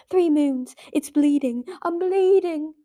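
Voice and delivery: high, loud panicked outcry; shrieking. A narrator's reading. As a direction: wail over the wound, pitch and volume up. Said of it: "Blister shrieked".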